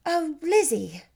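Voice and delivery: a gentle elder sister shaking her head; Jane, doubting. Acted.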